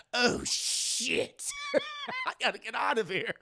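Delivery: hushed curse, high giggle, then quick; a villain slipping out, amused; whispered Joker. Retold or imitated. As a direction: sneak off giggling. Joker flees the casino.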